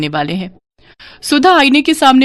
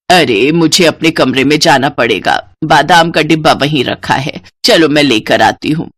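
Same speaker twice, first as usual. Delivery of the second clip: mother-in-law; 6 semitones lower than usual. Acted. Mother-in-law Sarla answers.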